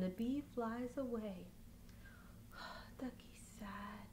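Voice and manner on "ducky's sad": hushed, sighing whisper; sad whisper. A narrator's reading. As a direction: whisper how sad Ducky is. Narrating sad moment to baby.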